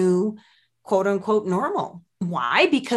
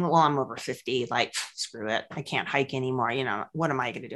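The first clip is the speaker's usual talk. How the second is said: lower, softer, giving-up grumble; defeatist mock; 7 semitones lower, 5 dB quieter than usual. Mocking people over fifty, low resigned grumble. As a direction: sound like you've given up on aging. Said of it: "I'm just not buying into this thing".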